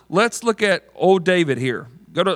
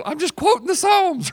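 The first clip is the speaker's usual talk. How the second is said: high, squeaky self-defense; mock-defensive, pitched way up; defensive protest; 12 semitones higher than usual. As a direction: plead innocence in a high-pitched voice. Acting the defensive reply to objection.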